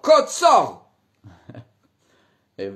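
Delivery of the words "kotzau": shouted name backwards; backwards speech. Acted. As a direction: yell it in reverse. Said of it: "rückwärts".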